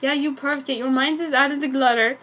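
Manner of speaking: plain reading voice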